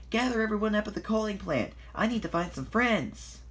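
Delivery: high, excited instructions; eager urging. A narrator's reading. Thomas sends Emily to gather friends, eager urging.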